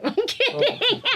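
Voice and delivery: high laughter, words slowed through giggles; laughing, kidding. Real, not acted. Laughing as she tells the interviewer she's kidding. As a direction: take the joke back mid-giggle.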